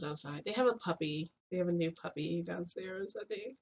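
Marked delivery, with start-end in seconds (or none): none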